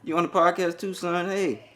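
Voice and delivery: lightly voiced little kid; imitating son on call. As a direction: play the kid asking back. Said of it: "He said".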